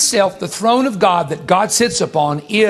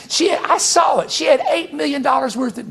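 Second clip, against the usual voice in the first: carried-away shout; 7 semitones higher than usual. Real, not acted. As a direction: get swept up and yell it. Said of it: "there I go again. I gotta quit doing that".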